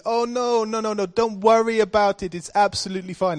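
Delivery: put-on old Welsh lady reassuring him, in a half-joking Indian accent. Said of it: "That's an Indian accent, isn't it?".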